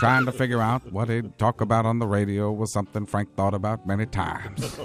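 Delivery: a documentary narrator's low voice; Morgan Freeman. Retold or imitated. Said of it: "Morgan Freeman has taken over my life".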